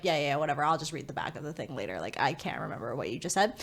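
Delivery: low, soft, offhand retelling; dismissive brush-off. Retold or imitated. Owner half-listening to the instructions, dismissive. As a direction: wave it away under your breath.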